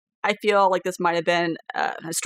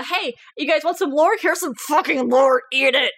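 pushy lore peddler; 4 semitones higher than usual. Pretend. Game master jokingly force-feeding lore to players, pushy mock hard sell. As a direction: shove it at them with mock force.

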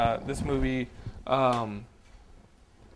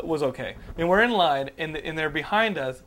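ordinary talk

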